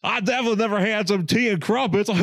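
high, mock Southern accent; bad Southern accent. Co-host mocking the British guy posing as Southern, bad Southern accent. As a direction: badly fake being a Southerner, accent overdone. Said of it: "this British guy trying to hang on to this Southern accent".

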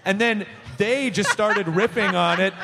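normal talking voice

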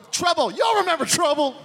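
high, triumphant recovery; forgetful hack comic. Acted. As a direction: save the bit with sudden hype. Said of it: "tries to save it at the end".

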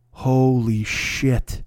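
slow, hushed-down realization, each word stretched; drawn-out dismay. Retold or imitated. Stall neighbour discovering his own empty roll, slow dismay. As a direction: let each word sink in slowly. Neighbour finds he has no paper.

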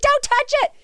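high, frantic mock bride; the bride desperate to stop her; panicked bride. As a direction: squeal it in alarm. Bride panics over her phone.

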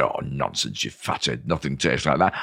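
low, quiet grumble of disbelief; imitating an unimpressed customer; dismissive sceptic. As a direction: scoff softly, pitched well down. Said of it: "And they say".

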